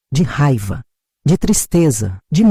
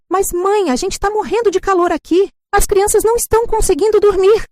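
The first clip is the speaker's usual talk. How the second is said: higher, whiny daughter begging her mother; pleading daughter; 12 semitones higher than usual. Acted. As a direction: plead like a grown child used to getting money.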